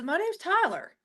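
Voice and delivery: Taylor's voice. Retold or imitated. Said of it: "She goes".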